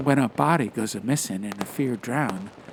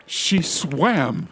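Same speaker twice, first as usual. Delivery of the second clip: enthusiastic; 5 semitones higher, 5 dB louder than usual. Acted. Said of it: "said Duroc enthusiastically".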